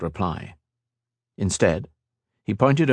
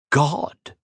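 scoffing; 7 semitones higher than usual. Acted. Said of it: "Scoffed Shinzan".

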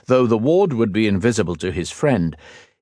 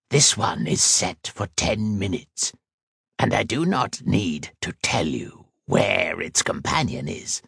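cold creature voice. A narrator's reading.

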